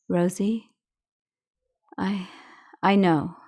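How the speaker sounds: halting, emotion stopping the words; understated choked-up hesitation; choking to a halt. A narrator's reading. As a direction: falter with long gaps, overwhelmed. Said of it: "I choked to a halt".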